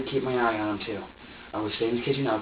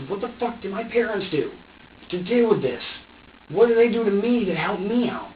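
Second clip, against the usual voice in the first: frantic questioning; 8 semitones higher, 4 dB louder than usual. Retold or imitated. Retold frantic thoughts about parents.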